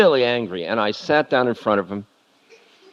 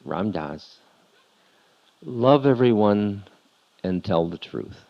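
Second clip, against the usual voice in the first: tender guru; 2 semitones lower than usual. Retold or imitated. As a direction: lean in and say it gently, nose to nose. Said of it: "he leaned forward and he said".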